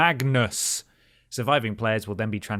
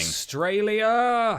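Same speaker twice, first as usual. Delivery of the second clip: high, long-drawn hammed-up wail; mock outcry; 10 semitones higher, much slower than usual. Co-host cutting in about the banning country, high drawn-out mock outcry. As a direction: stretch it out high in mock lament.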